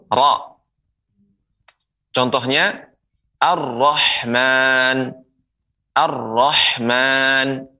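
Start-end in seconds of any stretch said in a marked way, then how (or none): 3.4-7.7 very slow "ar-Rahman", held r and long final vowel; doubled r. Shown as a demonstration. Quran teacher models the letter ra, doubled r. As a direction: stretch out "Ar-Rahman" in careful recitation. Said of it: "Nah, begitu".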